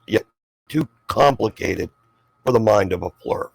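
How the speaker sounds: ordinary talk, nothing marked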